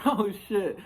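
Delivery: high, loud, gleeful oh shit; gloating exclamation. Retold or imitated. Gloating over the knocked-down opponent, a high, loud oh shit. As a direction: crow it out like you just won. Gloating right after landing the punch.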